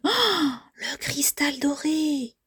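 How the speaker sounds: high, breathy, drawn-out marvel; gasp, then stretch the words in wonder; awestruck wonder. Acted. Characters marvel at the golden crystal. (émerveillement soufflé, aigu et lent)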